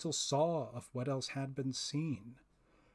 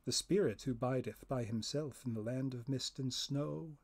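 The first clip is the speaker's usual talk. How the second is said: ordinary delivery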